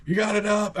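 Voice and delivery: high, hollered mock call; imitating yelling dad. Dad calling down to kid, mock yell. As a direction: call it from up a hill. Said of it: "he's way up on the hill yelling".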